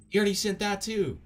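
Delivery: loud, high-pitched exasperated yell; exasperated shout. Real, not acted. Streamer fed up with repeated messages, exasperated shout.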